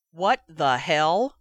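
slow, loud, shocked outrage; angry brother reading gossip, loud and slow; stunned anger. A narrator's reading. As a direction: drag it out in disbelief. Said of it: "All amusement was gone".

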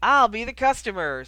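tipsy friend announcing loudly. A narrator's reading. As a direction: call it out so the whole bar hears. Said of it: "said Harry loudly".

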